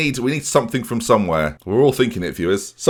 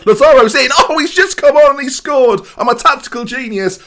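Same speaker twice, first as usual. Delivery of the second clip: high, loud goal celebration; elated commentator yell; ecstatic goal call; 6 dB louder than usual. Real, not acted. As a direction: scream with joy at the goal. Substitute scores late goal.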